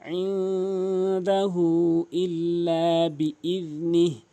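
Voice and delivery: slow melodic recitation; tajweed recitation; Quran recitation. Acted. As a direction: chant it slowly and evenly.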